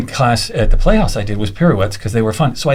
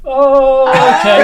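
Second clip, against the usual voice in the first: high, loud nervous laugh; nervous surprise; 11 semitones higher, 4 dB louder, much slower than usual. Retold or imitated. Dancer startled when choreographer joins him, high nervous laugh. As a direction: squeak it out, caught off guard. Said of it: "And I thought".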